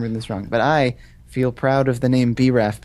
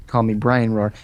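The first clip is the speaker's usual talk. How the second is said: ordinary talk, nothing marked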